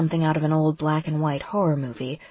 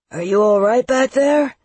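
raised, louder call; yelling; 6 semitones higher, 6 dB louder than usual. A narrator's reading. Seth yells to his passenger on the motorbike. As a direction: shout back over the engine. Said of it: "Seth yells".

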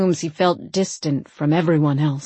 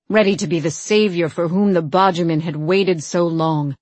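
plain reading voice

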